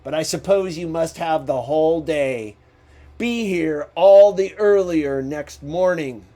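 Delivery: growling Scrooge. A narrator's reading. Scrooge to his clerk leaving the office, loud and gruff. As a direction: grudgingly give in, then lay down the order. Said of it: "walked out with a growl".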